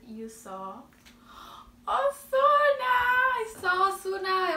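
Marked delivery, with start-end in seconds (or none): 1.9-4.5 high, loud burst of excitement; excited shout. Real, not acted. Fan thrilled to name her last concert, excited shout. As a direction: cry it out, drawing out the name.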